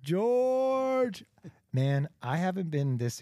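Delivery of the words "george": big excited hello to George; excited greeting. Real, not acted. Host greeting his guest excitedly.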